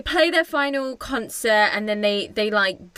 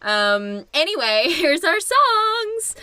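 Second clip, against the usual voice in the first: Barbie babbling a cheery excuse to the crowd; mock Barbie; 3 semitones higher than usual. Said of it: "And they're like".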